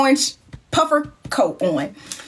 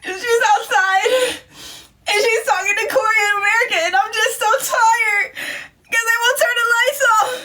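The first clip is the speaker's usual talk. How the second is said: mock weepy friend; 11 semitones higher than usual. Drunk friend whining she wants to sleep, high weepy mock voice. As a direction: whimper out how tired she is. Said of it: "She was like".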